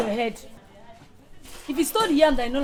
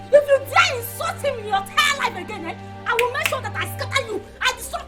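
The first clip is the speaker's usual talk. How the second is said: angry threat; 8 semitones higher, 4 dB louder than usual. Acted. Threatening the seller over insult.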